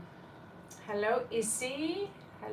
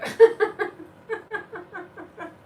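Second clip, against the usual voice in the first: loud, high burst of cackling; uncontrollable laughing; 9 semitones higher, 9 dB louder than usual. Real, not acted. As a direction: explode into a long real laugh. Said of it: "I've been laughing all day".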